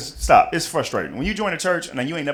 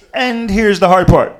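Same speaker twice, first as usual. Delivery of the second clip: ordinary delivery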